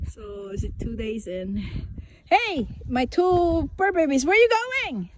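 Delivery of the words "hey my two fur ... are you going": high, bright greeting to the dogs, louder; high-pitched hello to two fur babies; excited pet talk. Real, not acted. Greeting her dogs coming home.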